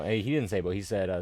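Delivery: ordinary talk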